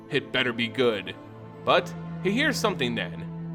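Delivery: usual speaking voice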